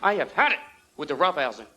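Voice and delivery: impression of an exasperated dad; fed-up smoking dad. Dad scolding kids while exhaling smoke, fed-up impression. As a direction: lay down the law as a smoking father. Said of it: "He'd be like".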